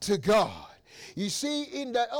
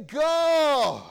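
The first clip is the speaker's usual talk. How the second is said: cry out; 4 semitones higher, 5 dB louder than usual. Acted. Hannah crying out to God.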